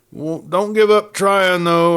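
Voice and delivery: a cheerful prisoner urging the sheriff. A narrator's reading. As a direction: coax him on with real gusto. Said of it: "urged Gloster".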